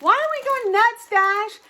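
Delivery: loud, high-pitched questioning call; calling to the dog. Real, not acted. Owner asks Dash why the fuss, loud high call.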